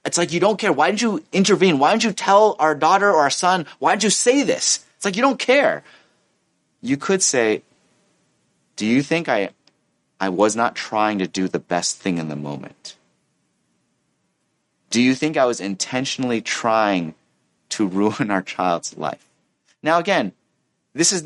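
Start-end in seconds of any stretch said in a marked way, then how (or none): 0.3-4.8 high-pitched, accusing rapid-fire questions; acted exasperated parent's tirade; upset parent. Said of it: "one parent is upset". 5.2-5.8 very high exasperated accusation; acted, wounded outburst; upset parent. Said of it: "one parent is upset". 8.8-19.3 composed, restrained questioning; gentle question. Acted. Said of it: "you could ask in a gentle tone".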